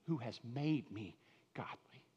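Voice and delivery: breathy whisper of awe. Acted. Preacher whispering to his flock. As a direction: let it fall to a whisper.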